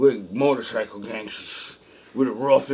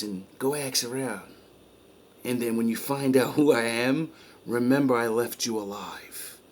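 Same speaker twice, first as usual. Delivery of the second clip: soft threat; 5 dB quieter than usual. Retold or imitated. Boss warns beaten man.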